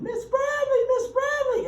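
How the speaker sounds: high, loud mock scream of excited kids; imitating children yelling for their teacher across the lawn; kids screaming her name. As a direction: shriek the name like kids sprinting over. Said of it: "And they're screaming".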